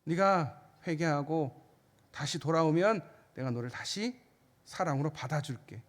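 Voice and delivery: a father's soft promise to return; tender God's voice. Acted.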